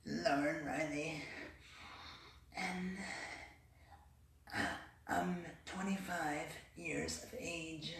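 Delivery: soft, slow, hesitant practice voice; old practice voice. Shown as a demonstration. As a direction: say it slowly and softly, afraid to project. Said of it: "So I would go".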